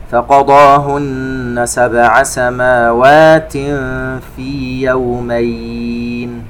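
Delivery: slow, melodic tajweed chant; measured Quranic recitation. Shown as a demonstration. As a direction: recite slowly with held nasal sounds.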